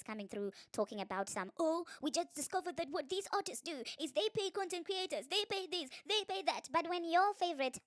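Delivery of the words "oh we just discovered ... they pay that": high-pitched mocking imitation; put-on amazed tattletale voice; mock revelation. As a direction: parody a big discovery in a raised voice. Mocking critics' sudden discovery.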